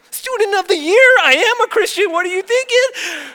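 high, loud mock outrage; indignant retort. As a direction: shout back as the offended teen. Acted angry retort to mom.

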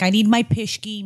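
ordinary talk, nothing marked

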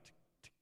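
breathy whispered clock ticks; faint unvoiced clicking. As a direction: tick softly like a hidden clock. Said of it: "it would've been like".

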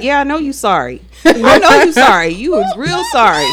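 mock sarcastic mother; petty. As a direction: sneer it back, petty. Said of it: "you gotta be petty".

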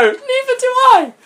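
very high squeaky exasperation; exasperated squeak. Real, not acted. Host mocking the story, high squeak. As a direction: squeak it in disbelief.

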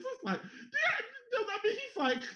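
high giggling laugh through the words; cracking up while talking. Real, not acted. Laughing while telling friends about the trade.

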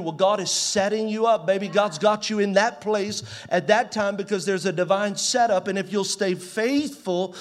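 loud, rising sermon cry; preacher at full volume, driving the point home; full-force preaching. Acted. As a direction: preach it out to the whole room.

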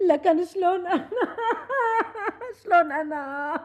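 self-pitying laugh turning to a sob; laughing through tears. Acted. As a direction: laugh that breaks into crying. Laugh-cry lament about herself. (ضحك ممزوج بالبكاء على حالها)

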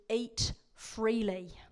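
slow, spacious quote of God's permission; generous command. Acted. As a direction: stretch both words out generously.

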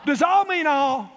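slow, loud, all hammered; shouted challenge. Real, not acted. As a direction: demand an answer from the church. Preacher pressing the point.

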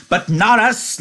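put-on high, joyful childlike cry; happy cry. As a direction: cry out happily. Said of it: "Lenny cried happily".

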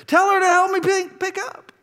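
put-on very high-pitched child's complaint; six-year-old tattling. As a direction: imitate a small child telling on a sibling. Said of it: "my six-year-old said this".

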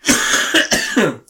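loud hacking cough; real, uncontrolled burst of coughing; coughing fit. Real, not acted. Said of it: "Stop coughing".

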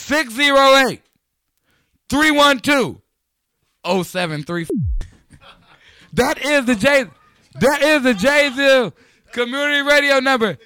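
high, loud hype-man call for the number; loud announcer read. Acted. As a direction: belt out the hotline number like a radio promo.

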